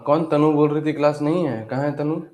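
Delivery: usual speaking voice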